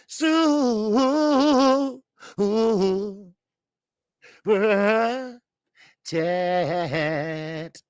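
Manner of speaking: long wavering "soo-woo" call; drawn-out opening holler; drawn-out whoop. Real, not acted. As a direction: stretch the call out in one long hoot.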